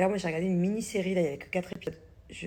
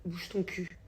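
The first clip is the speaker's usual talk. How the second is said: mild mock scolding; 4 dB quieter, much slower than usual. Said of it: "j'ai dit à son frère".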